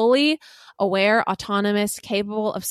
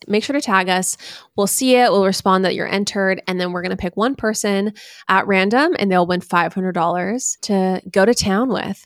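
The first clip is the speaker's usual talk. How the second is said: louder upbeat promo read; hyped podcast announcement; 7 dB louder than usual. Acted. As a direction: sell the giveaway like a host. Giveaway ad read.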